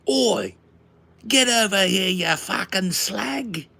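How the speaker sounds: loud, high-pitched rough British yell; mock Scouser. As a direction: holler like a hammed-up Scouser. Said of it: "Are you one of them Scousers?".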